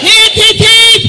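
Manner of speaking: hooray cheer. Real, not acted. Leading a cheer.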